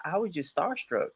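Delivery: small, soft mock-sheepish voice; meek excuse. Her excuse for not following back, meekly imitated. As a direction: drop to a timid little reply.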